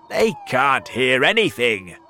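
loud, bold dismissal; loud cry. A narrator's reading. Centipede dismisses his friends' worry, crying out. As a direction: cry it out boldly. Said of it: "he cried".

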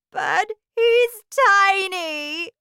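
high, loud, drawn-out wail; mother's distressed cry. A narrator's reading. Mother horrified at her shrunken son, crying out. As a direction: wail it slowly in dismay. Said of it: "cried Missus T V".

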